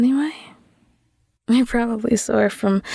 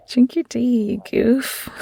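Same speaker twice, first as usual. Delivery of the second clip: ordinary talk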